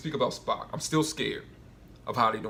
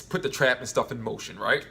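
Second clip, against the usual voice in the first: ordinary talk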